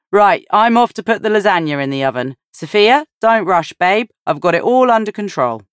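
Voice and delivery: husband calling back to his wife while leaving, yelling; yelled back. A narrator's reading. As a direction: shout it back while walking away, quickly. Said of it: "he yelled back over his shoulder".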